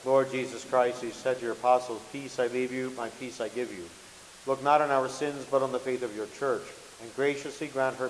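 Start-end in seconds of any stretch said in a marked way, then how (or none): none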